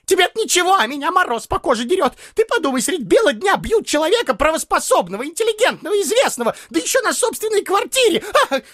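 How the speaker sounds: high, fast, wailing complaint; tearful voice. A narrator's reading. Neighbour appalled at the beating, tearful protest. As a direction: lament in a very high, hurried whine. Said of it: "проговорил плачущим голосом Почечуев".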